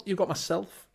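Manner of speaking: usual speaking voice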